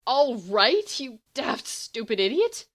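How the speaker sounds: loud, cracking furious retort; furious stunned outburst. A narrator's reading. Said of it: "sounding stunned and furious".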